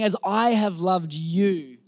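slow quote. Shown as a demonstration. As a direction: linger over each word. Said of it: "Jesus is saying".

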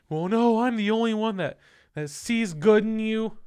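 high, loud imitation of her protest; pleading girlfriend. Girlfriend arguing against being sent away, high mock plea.

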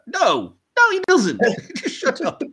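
high, squealed refusal breaking into a laugh; exasperated protest. Real, not acted. Podcaster tells the feather joke to shut up, exasperated. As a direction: shoot the gag down, voice leaping up.

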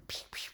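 soft mock gunshot sounds; gun noises. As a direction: puff out little pew noises. Imagined intruder shooting her.

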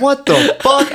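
startled outburst. Retold or imitated. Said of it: "I said".

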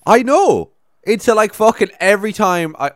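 building exasperation; ranting, fed up; worked up. Real, not acted. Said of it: "I got so irrationally angry".

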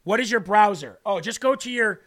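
ordinary talk

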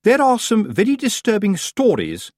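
high, affected insinuation; portentous, pitched well up; ominous society witch. A narrator's reading. Missus Earwig watching Tiffany, ominously insinuating.

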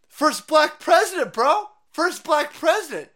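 high, loud hammed-up cheering; mock triumphant shout. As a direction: yell it like a giddy fan.

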